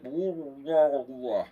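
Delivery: loud, drawn-out garbled mouth noise; marble-mouthed wah-wah. Shown as a demonstration. Streamer showing how Russian speakers sound, loud marble-mouthed garble. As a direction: imitate talking through a mouthful of marbles. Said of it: "put a bunch of marbles in your mouth".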